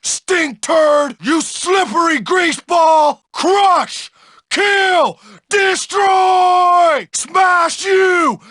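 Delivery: high-pitched fighting shout; raging brute. Acted. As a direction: bellow threats in a smashing frenzy. Bully attacks with threats.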